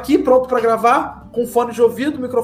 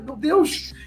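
high startled cry; startled exclamation; 8 semitones higher than usual. Retold or imitated. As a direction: gasp it out in shock. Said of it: "Eu falei".

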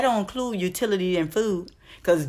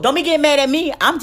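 defensive; 7 semitones higher, 7 dB louder, faster than usual. Real, not acted. Defending herself to viewers.

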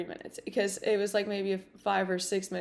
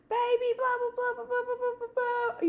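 very high babble down the phone; squeaky-high run of excited blahs; high-pitched, excited mom; faster than usual. Retold or imitated. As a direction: imitate a thrilled mother gushing. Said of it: "high pitched, you know, excited".

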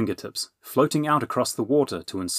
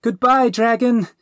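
high, loud, drawn-out farewell call; 14 semitones higher, 9 dB louder, slower than usual. Acted. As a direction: call after her friend as she vanishes. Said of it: "the princess called".

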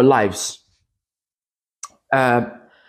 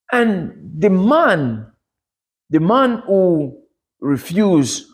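slow dramatic build; 6 semitones higher, much slower than usual. Real, not acted.

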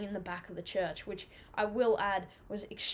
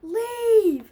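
high, loud angry shout; acting out a friend losing his temper; angry yell; 12 semitones higher, 11 dB louder, much slower than usual. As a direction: yell it to chase the boys off. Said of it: "he was getting angry".

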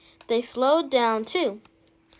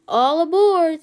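louder, higher rallying call; 6 semitones higher, 7 dB louder than usual. Acted. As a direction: call everyone aboard to tow. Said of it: "said Lim".

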